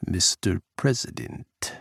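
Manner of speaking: put-on a light Russian accent, drawn out; Russian president greeting Jack in a Russian accent. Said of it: "Pukov's rough accent grated".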